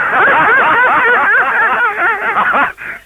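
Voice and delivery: high, near-crying laugh; laughing at own punchline. Real, not acted. Joke teller laughing at his punchline, near-crying laugh. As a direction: cracks up at the end.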